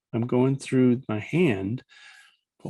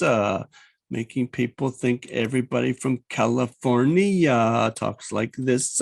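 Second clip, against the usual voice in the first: mocking impression of a drawling Californian comic; Pauly Shore. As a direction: stretch the word endings in an annoying slacker voice. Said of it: "a guy on there called Pauly Shore".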